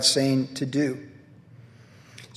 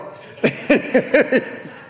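breathy laugh; 9 semitones higher than usual. Real, not acted. Laughing at himself.

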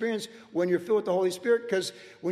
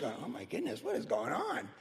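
amazed hush; 5 semitones higher, 9 dB quieter than usual. Retold or imitated. Wife sees him laughing.

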